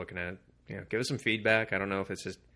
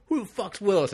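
mock tough demand; 11 semitones higher, 6 dB louder than usual. Prisoner demands Willis, retold.

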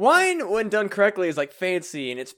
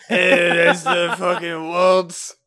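slow, slurred mock French drunk; drunk French accent; 5 dB louder, slower than usual. Host doing a drunk homeless French man, laughing. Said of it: "I just sound like a fucking drunk homeless person".

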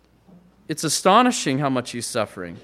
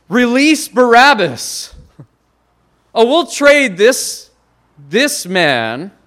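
voicing the crowd, higher, louder and slow; preacher's mock crowd cry, higher and louder; crowd demand; 5 semitones higher, 7 dB louder, slower than usual. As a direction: cry it out like the mob demanding Barabbas. Crowd demands Barabbas released.

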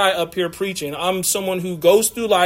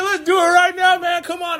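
much higher, eager rush of words; pitched-up eager dad; excited dad; 11 semitones higher than usual. Retold or imitated. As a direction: jump at the idea like a thrilled father. Dad jumps at baptism idea.

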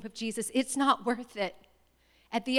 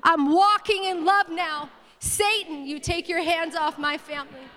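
high, ringing declaration, easing at the end; rally the church one last time; raised, emphatic congregational lead; like you mean it; 5 semitones higher, 4 dB louder than usual. Shown as a demonstration. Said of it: "Say it like you mean it".

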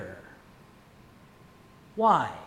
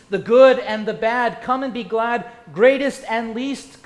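rhythmic hymn-like recitation; recited verse; 7 dB louder than usual. Acted. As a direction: recite it like a poem. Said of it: "The feast is ready".

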